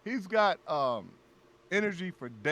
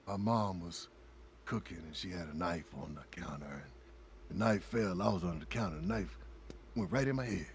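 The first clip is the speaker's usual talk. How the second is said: Billy's mellow voice; 5 semitones lower, 7 dB quieter than usual. Retold or imitated. Billy telling his knife story to friends, lightly mellow voice. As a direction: calmly tell a tall tale. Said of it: "he had that deep voice".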